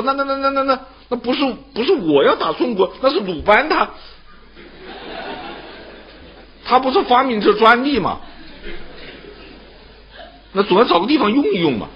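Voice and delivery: high, stammering, sheepish excuse; a cornered king stumbling to shift blame, pitched high; flustered stammering king. Acted. (被墨子问住的楚王，高声结巴推卸责任)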